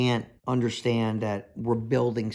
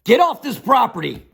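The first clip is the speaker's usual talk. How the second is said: mock challenge, raised pitch and voice; confrontational call; 8 semitones higher, 4 dB louder than usual. Builder to nosy onlookers, confrontational call. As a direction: call out sharply to chase them off. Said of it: "I don't directly say".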